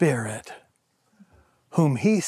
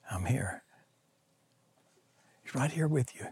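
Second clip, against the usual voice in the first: much softer, tender and close; gentle reassurance; 11 dB quieter than usual. Acted. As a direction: comfort someone worn out. God's voice comforting the weary.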